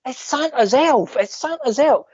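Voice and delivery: put-on high, sing-song kid insisting; imitating insistent girl. Little girl insisting to mum it's Santa's elf, high. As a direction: chant it like a kid who is sure. Child insisting to her mother.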